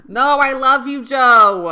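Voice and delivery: loud, high, hammed-up Massachusetts accent; over-the-top mock Massachusetts movie outburst; bad Massachusetts accent. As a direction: belt it out in a badly faked Massachusetts accent. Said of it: "I can't do a Massachusetts accent".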